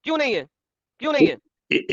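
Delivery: high, repeated shouted demand; shouting. Real, not acted. Debater badgering opponent for an answer, shouting. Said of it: "चिल्लाओ मत".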